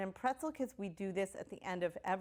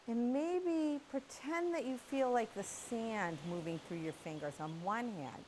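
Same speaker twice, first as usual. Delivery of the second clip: guided visualization; 4 dB quieter than usual. Acted. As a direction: lull the children gently into a calm beach daydream.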